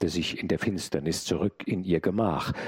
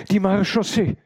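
high, very loud alarm calls; screaming for help; 9 dB louder than usual. A narrator's reading. Maid hears horsemen in the street, screams for help. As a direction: scream for the mounted police, rising in force. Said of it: "schrie die Martinière".

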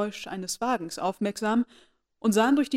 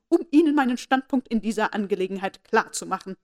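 loud, higher, indignant grande dame; haughty aunt scolding about the rumour; angry; 4 semitones higher, 7 dB louder than usual. A narrator's reading. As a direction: lecture her with outraged authority. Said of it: "in ärgerlichem Ton".

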